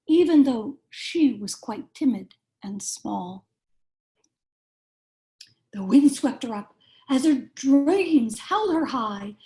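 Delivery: lively picture-book read-aloud, rhyming bounce; reading to children. A narrator's reading. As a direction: read it like bedtime story time for little kids.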